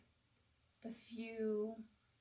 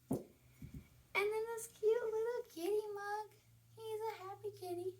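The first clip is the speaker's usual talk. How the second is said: higher, slow, cooing over the cute mug; cutesy voice; 11 semitones higher than usual. Real, not acted. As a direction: coo like you're talking to a kitten. Showing off a cute kitty mug.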